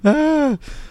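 short high laugh; amused laugh. Real, not acted. Host reacting to the clip, high laugh. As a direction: let out a high burst of laughter.

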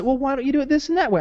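quick, high nagging-mom imitation; lightly mocked motherly questioning; quoted mom. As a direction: rattle it off like a fussing mother. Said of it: "my mom was getting a little".

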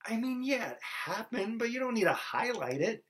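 very high-pitched, reluctant admission; embarrassed mock protest. An objector begs Matthew not to highlight the exile, high mock protest. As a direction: squeak out a grudging concession, playing the objector.

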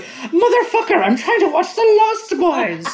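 high, loud exaggerated British accent; bad British slip. Actor's British accent bursts through, hammed-up outburst. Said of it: "his accent bursts through so badly".

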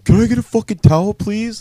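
high, loud, rapid mock outburst; agitated demand. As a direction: imitate a worked-up wrestler demanding help. Retold agitated demand from injured wrestler.

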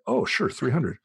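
a hint of a naive tourist agreeing happily; breezy elderly lady. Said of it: "who are just like".

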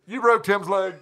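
yelled out with a laugh. Retold or imitated. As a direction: call it out across the street, laughing. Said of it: "cups his mouth and yells at me".

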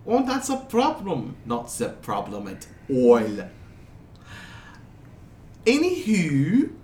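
put-on lilting European accent, high openings; wandering foreign accent. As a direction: play a muddled foreigner brushing it off. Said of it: "his accent keeps jumping around a lot".

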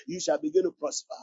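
usual speaking voice

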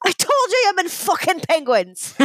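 high-pitched mock outburst for the punchline; exasperated leprechaun, pitched right up; angry leprechaun. Posh leprechaun beating his drunk friend, mock-angry high outburst.

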